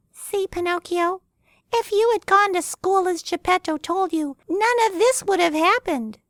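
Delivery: very high chiding cricket; scolding cricket. Acted. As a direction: scold him in a tiny high voice. Cricket scolds caged Pinocchio.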